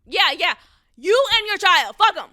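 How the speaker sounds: real furious rant; venting rage at a complaining parent, loud and high; angry outburst. Real, not acted.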